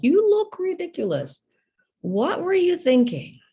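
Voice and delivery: high, loud mock scolding; imitated scornful parent. A father to his little girl, imitated scolding. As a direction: imitate an exasperated parent berating a child.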